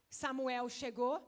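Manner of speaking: ordinary talk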